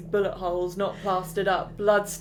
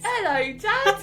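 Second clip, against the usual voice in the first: high laughing mock Cockney child; a put-on Cockney kid greeting; hammed-up Cockney ghost child; bad Cockney accent; 12 semitones higher, slower than usual. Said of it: "really shit Cockney accents".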